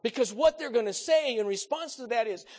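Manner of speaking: high, loud fervent preaching; preaching at full force. Real, not acted. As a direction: build the sermon to its peak.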